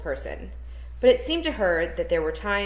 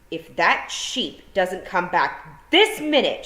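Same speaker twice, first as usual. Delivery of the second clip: loud, slow threatening shout; 3 semitones higher, 7 dB louder, much slower than usual. A narrator's reading. Tiffany threatening the hidden thieves, slow loud shout. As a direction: bellow it at the sky, slowly. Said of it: "she shouted at the sky".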